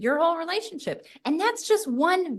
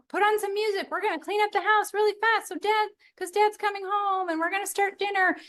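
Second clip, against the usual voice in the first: cheerful cleaning call; 8 semitones higher than usual. Retold or imitated. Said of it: "you know".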